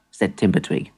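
ordinary delivery

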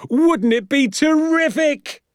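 father bellowing his delight while pacing; excited shout. Acted. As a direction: stretch it out in a thrilled shout. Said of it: "he shouted".

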